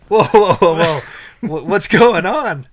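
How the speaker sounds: loud, high-pitched mock alarm; startled wife. Wife reacting to her crying husband, startled. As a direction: cut in loudly, imitating sudden concern.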